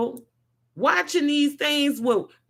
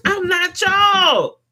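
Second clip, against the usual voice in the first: higher, louder, slow declaration; defiant shout; 6 semitones higher, 4 dB louder, slower than usual. Real, not acted. Vlogger separates himself from hypocrites, defiant shout. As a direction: throw it out defiantly.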